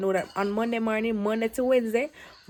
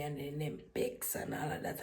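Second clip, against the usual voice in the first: ordinary talk, nothing marked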